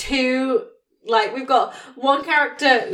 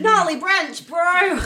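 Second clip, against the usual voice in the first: higher, laid-back dude impression; surfer dude voice; 7 semitones higher, slower than usual. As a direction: toss it off like a chilled-out surfer. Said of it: "she's like".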